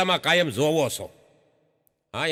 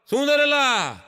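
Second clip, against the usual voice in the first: calling out; 6 semitones higher, slower than usual. Retold or imitated. Calling out to brother-in-law.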